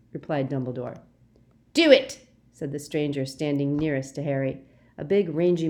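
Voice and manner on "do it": loud high bark; rough bark of an order; urging command. Acted. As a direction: push him to kill. Stranger urging Draco.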